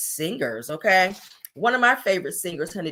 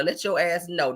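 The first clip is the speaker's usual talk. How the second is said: ordinary delivery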